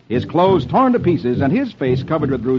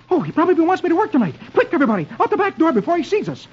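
high, fast panicky comic voice; Dagwood exclaiming; faster than usual. Acted. Husband rushing family out the back, high and flustered. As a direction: hurry it out in a squeaky fluster. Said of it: "Dagwood exclaims".

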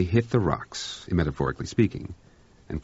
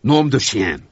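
louder French oath; exclamation; 5 semitones higher, 7 dB louder, slower than usual. Acted. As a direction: blurt it out, exasperated. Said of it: "ejaculated Blanchard".

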